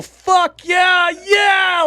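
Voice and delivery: high, loud whoop of joy, fuck yeah; thrilled, loud fuck yeah; excited shout. Retold or imitated. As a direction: yell it like you just won. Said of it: "like that".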